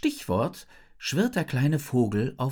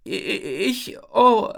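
high, flustered stutter rising to loud; stammering; 8 semitones higher, 6 dB louder, slower than usual. A narrator's reading. Isabel is stuck in the rising ice, stammering. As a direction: stumble over the first sound in alarm. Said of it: "stammle ich".

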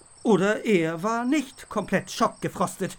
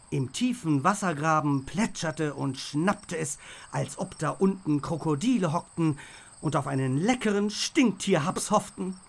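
plain reading voice

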